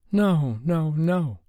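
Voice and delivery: high, tearful pleading no; sobbing no. A narrator's reading. Sobbing in Dave's arms, high and broken. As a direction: Sophie sobbing through her nightmare. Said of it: "she sobbed over and over".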